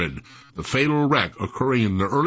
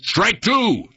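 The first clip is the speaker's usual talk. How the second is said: high, emphatic umpire's cry; umpire's call; 9 semitones higher, 3 dB louder than usual. A narrator's reading. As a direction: call out the second strike like an umpire. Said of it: "and the umpire said".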